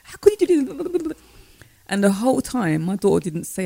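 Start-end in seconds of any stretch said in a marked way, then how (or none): 0.0-1.2 high shrieking mock outrage; frantic high-pitched scolding; losing it. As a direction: replay a mother losing her temper at her child. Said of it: "I completely lost it".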